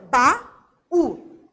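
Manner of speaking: "Ba-u" broken in two, both parts loud; syllable split. Shown as a demonstration. Teacher separating the hiatus for pupils, loud syllable by syllable. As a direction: say "Ba", pause, then a strong "u". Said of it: "U é tônico?".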